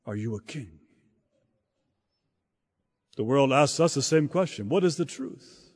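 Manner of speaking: ordinary talk, nothing marked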